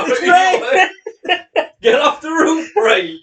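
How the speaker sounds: loud, high, through laughter; laughing shout. Real, not acted. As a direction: yell it while cracking up.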